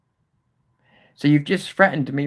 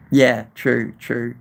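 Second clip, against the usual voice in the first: ordinary delivery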